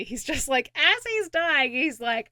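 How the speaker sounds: ordinary talk, nothing marked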